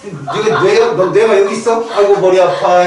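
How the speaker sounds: mock complaint. Teacher mimicking someone with a headache, mock complaint. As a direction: act out an exaggerated moan. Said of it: "이러고 다냐?".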